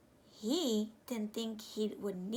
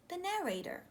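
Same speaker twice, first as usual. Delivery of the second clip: usual speaking voice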